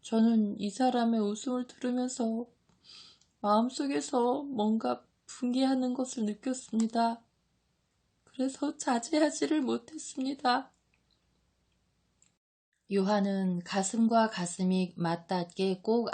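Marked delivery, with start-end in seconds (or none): 0.0-10.7 high, weeping disciple voice; tearful apology. A narrator's reading. John explains his outburst to Jesus, tearful. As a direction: confess through acted tears.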